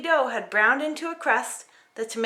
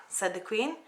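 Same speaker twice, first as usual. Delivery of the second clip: ordinary delivery, nothing marked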